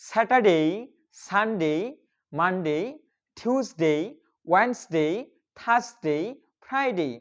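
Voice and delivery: one day at a time with pauses; practice drill. Shown as a demonstration. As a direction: model each word separately for learners to repeat. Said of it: "চলুন আমার সাথে practice করতে". (একটি একটি করে থেমে অনুশীলনের উচ্চারণ)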